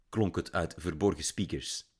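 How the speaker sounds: ordinary delivery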